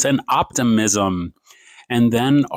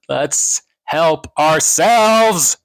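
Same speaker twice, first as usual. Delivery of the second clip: loud slow call; 3 semitones higher, 5 dB louder, much slower than usual. Real, not acted. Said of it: "I probably just woke up my neighbor".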